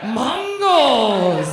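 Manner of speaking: loud mocking "Mongols" with round vowels; mock accent shout. Comic mocking an audience member's accent, loud mock shout.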